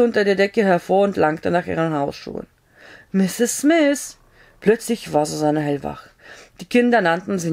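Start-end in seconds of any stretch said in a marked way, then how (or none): 3.1-4.2 high call from outside; a man calls from the back door at night, high. A narrator's reading. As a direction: call her name again through the door. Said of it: "Irgendjemand hatte sie gerade gerufen".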